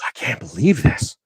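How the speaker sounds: hushed, drawn-out disbelief; softly mimicked jaw-drop amazement; stunned disbelief. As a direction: imitate a counselor too floored to speak up. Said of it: "the lady's mouth's just dropping".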